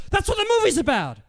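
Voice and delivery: very high-pitched mock exclamation; reenacted high, strained cry of discovery; excited realisation. Reenacts his sudden realisation on the walk.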